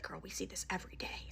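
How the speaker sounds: hushed aside, imitating the worker; very soft, voiceless murmur; confiding whisper. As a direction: whisper it to a customer. Worker confiding quietly to customers.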